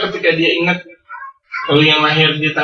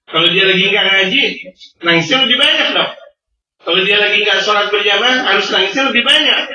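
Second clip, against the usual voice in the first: fiery preaching; 4 semitones higher than usual. Acted. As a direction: drive the point home to the congregation. Preaching at full force. (ceramah berapi-api, nada tinggi dan keras)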